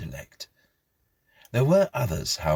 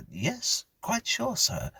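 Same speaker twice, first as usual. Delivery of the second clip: put-on higher, slow boy's voice; a careful child answering his elder; polite young boy; slower than usual.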